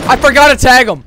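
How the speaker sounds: loud, high angry complaint; furious at himself, loud and high. Real, not acted. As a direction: snap it out loud in real anger. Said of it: "I'm fucking mad".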